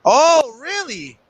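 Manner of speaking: high, rising surprised "oh", then dropping away; real surprise. Real, not acted. Co-host hears the guy was a lawyer, surprised. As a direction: let the news catch you off guard. Reacts to news about a character.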